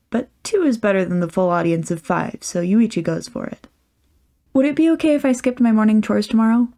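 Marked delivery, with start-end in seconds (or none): 4.5-6.7 fast, slightly higher nervous request; hurried hopeful question; asked quickly. Acted. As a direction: rush it out before losing nerve. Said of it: "he asks quickly".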